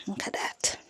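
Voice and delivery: barely voiced whisper. Real, not acted. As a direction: hush it to a breath. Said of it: "Say it louder".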